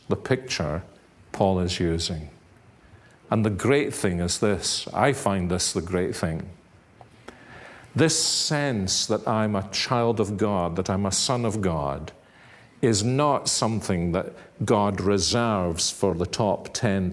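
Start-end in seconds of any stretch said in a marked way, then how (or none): none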